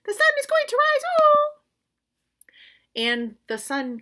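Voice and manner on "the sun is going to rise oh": high squeaky squirrel voice; high little squirrel piping up. Acted. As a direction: pipe up brightly like a tiny squirrel. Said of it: "piped up".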